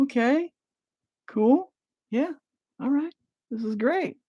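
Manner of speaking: high, forced-cheerful reply; father pretending all is fine, high and forced; uneasy parent. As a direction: go high and fake-upbeat. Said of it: "He's gonna be like".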